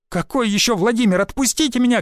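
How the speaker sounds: high-pitched indignant cry; wailing. A narrator's reading. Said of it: "голосила она". (голосит высоким голосом)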